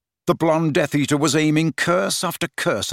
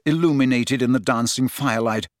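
ordinary delivery, nothing marked

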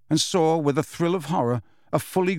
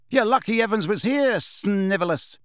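taunting; 7 semitones higher than usual. A narrator's reading. James to Snape after freeing him, taunting. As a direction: jeer it and stretch the name.

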